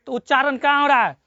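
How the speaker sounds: ordinary delivery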